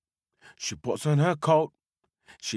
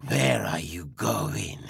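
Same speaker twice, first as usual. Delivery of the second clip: low, slow menacing wolf voice; hungry wolf; 3 semitones lower than usual. Acted. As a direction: growl at the old lady like a hungry beast. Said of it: "And the wolf says".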